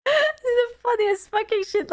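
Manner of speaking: high, laughing through the words; can barely get it out for laughing. Real, not acted. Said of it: "I am laughing".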